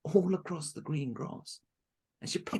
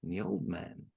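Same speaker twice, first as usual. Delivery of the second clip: plain reading voice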